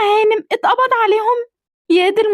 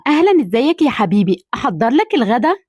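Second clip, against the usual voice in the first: much lower, gentle motherly voice; lower-pitched mother greeting her son; older mother voice; 9 semitones lower than usual. Acted. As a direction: speak as the doting mother.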